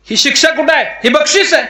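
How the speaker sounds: high-pitched incredulous protest; astonished objection. Acted. God objects to the odd punishment.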